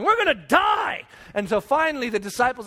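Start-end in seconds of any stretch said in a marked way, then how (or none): 0.0-1.0 high, slow panicked wail; mock panic. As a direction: wail like a frightened tax collector. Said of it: "Matthew's going".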